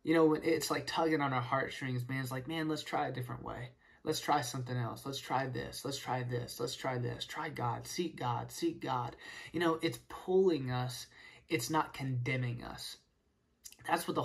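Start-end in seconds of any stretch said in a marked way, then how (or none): 2.5-9.2 gentle tug. Pretend. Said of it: "slow tug of a heart".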